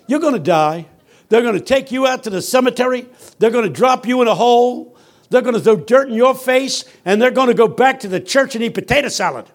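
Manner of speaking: high, loud, driving preacher; pastor's preaching. Retold or imitated. Pastor warning young people, high loud preaching. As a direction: hammer it home from the pulpit.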